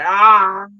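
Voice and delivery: loud, high groan of pain. Pretend. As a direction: let out a loud anguished ah. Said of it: "more appropriate to say".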